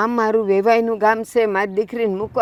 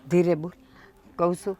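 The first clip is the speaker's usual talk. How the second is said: softer, hushed warning; 4 semitones lower, 6 dB quieter than usual. Acted. Mother hushes angry daughter, soft and hushed. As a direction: hush her quietly so nobody hears.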